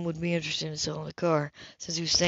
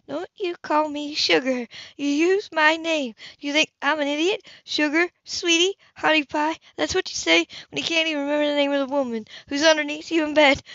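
higher, biting woman's voice; furious Hannah; 7 semitones higher than usual. Acted. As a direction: throw his pet names back in his face. Hannah furious at pet names.

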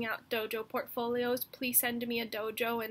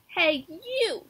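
loud, higher shout-out; bossy call; 7 semitones higher, 6 dB louder than usual. Acted. Mouse hailing the lizard, loud and cocky. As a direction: call across to a stranger, cocky. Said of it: "said the mouse".

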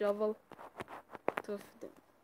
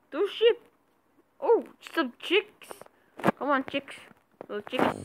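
excited; 7 semitones higher, 7 dB louder than usual. Real, not acted. Excited at animals.

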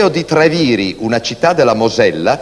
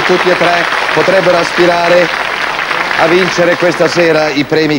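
showy game show host; 6 semitones higher than usual. Acted. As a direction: announce the winner like a TV host. Host announces winner with fanfare.

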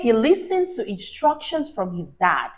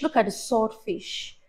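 ordinary delivery, nothing marked

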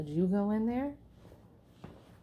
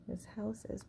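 whispering; 17 dB quieter than usual. Real, not acted. Reader checks picture quietly.

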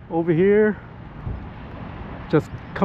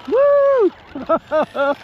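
excited whoop; 9 semitones higher than usual. Real, not acted. Cheering after the flight.

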